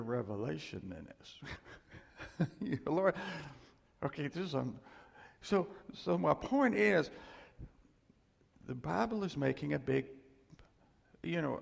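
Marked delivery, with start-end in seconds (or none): none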